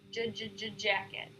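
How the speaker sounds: repeated j then jacket; tense stutter. Shown as a demonstration. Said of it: "repeat the word after the stutter".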